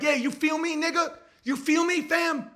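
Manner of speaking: high-pitched Chicago slang impression; mock Chicago confrontation voice; imitating Chicago street talk. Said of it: "'cause Chicago's, they're like".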